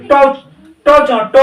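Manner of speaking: ট with the tongue bent back and up; retroflex ট. Shown as a demonstration. Teacher has class feel the tongue, retroflex ট. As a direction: curl the tongue up for each ট. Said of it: "জিভটা বেঁকে কোথায় যাচ্ছে".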